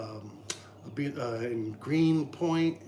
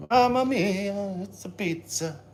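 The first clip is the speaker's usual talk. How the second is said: a lighter, slower Italian chef cry; jolly italian pizza chef; 6 semitones higher, 4 dB louder, slower than usual. Pretend. Repeat of the chef exclamation.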